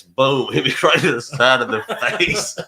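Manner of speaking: punchline bursting out with laughs; laughing through it. Real, not acted. Telling friend about getting hit in the wave pool, cracking up.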